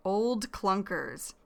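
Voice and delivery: put-on "Old clunkers" drawn out slowly and clearly; slow, careful repeat. Shown as a demonstration. Teacher repeating the vocabulary slowly for students. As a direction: model "Old clunkers" word by word for learners.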